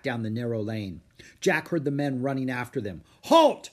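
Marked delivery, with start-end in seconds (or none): 3.2-3.7 loud, high shouted order; sharp, ringing military command; commanding cry. A narrator's reading. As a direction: shout it like a pursuing warrior. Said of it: "cried a samurai".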